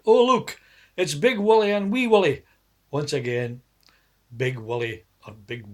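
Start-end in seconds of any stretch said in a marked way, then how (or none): 0.1-2.4 loud, high teasing call; mocking shout. Friends tease both Wallies, loud high shout. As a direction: shout it for the whole pub to hear. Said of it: "His friends would shout".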